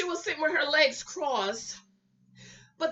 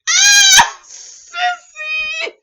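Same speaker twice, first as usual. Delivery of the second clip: very high, loud squeal of her name; excited shout; 15 dB louder, much slower than usual. Real, not acted. Squealing a viewer's name in delight, very high and loud.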